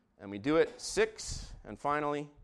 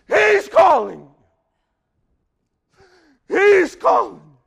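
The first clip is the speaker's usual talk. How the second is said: much higher, louder, slow; jubilant shout; 20 dB louder, much slower than usual. Acted. Crowd shouting to Bartimaeus that Jesus calls him. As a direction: call it out in triumph.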